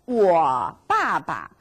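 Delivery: 我爸爸 said slowly, syllable by syllable; slow model. Shown as a demonstration. Teacher models 我爸爸 slowly for the class. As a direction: spell out 我爸爸 slowly for learners. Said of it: "My father".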